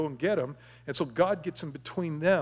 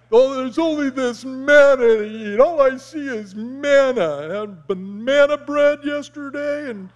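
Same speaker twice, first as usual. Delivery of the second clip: whiny complaining; 8 semitones higher, 10 dB louder than usual. Retold or imitated. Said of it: "they're griping about it".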